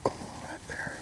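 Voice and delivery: hushed whisper, barely voiced. Real, not acted. Hunter coaxing a turkey closer in a hushed whisper. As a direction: breathe the words so the bird won't hear.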